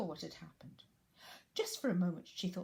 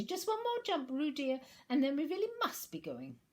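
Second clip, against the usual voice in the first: high, louder motherly urging; 9 semitones higher, 6 dB louder than usual. Acted. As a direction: coax the little one to hurry. Kanga urges Roo.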